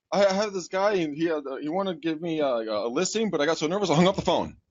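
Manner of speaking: lightly voiced flustered girlfriend; mock upset girlfriend. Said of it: "she's all upset".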